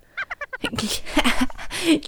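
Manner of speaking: hohes, fröhliches Kaninchenlachen; laut auflachen vor Freude; hohes Lachen. Acted. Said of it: "Karl sah an sich herab und lachte". (hohes Lachen)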